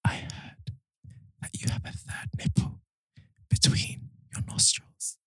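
soft breathy ASMR whisper, slow. Acted. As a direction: whisper close to the mic. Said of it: "deliver some bad or weird news".

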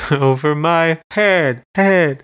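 high repeated word, echoing away; imitated vocal echo. Podcast host demonstrating homemade echoey repeats, imitated echo effect. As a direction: repeat it like a singer doing their own echo. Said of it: "doing their own repeats".

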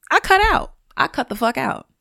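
sharp high burst on cut out; emphatic. Real, not acted. Podcaster telling listeners she walks away, emphatic burst. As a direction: slam the door on stupid talk. Emphatic about cutting people off.

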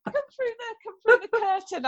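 high, squealy words through laughter; real laughter pushing the voice up; laughing. Real, not acted. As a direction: laugh helplessly while telling it. Laughing over falling through curtain.

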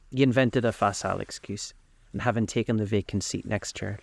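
usual speaking voice

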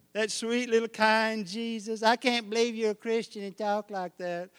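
lightly mocked churchgoer scandalised; mocking sugary piety. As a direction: sneer through a sweet-voice impression. Mocking shallow believers.

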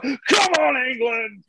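high cheering call; mock cheer. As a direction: cheer like a crowd at a race. Crowd cheering him on.

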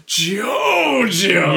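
loud, high villain yell; Dio shout. Retold or imitated. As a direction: bellow it like a vampire villain. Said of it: "He's just like".

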